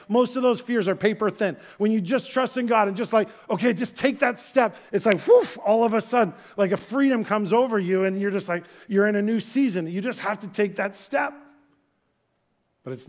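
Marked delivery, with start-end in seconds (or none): 0.1-11.3 loud, high, driving sermon delivery; fired-up pulpit voice; full-force preaching. Acted. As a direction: preach it out to the whole room.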